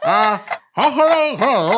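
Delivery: slow garbled words through a mouthpiece; mouth full. Real, not acted. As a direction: speak around a mouth guard. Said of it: "what I'm saying with my mouth full".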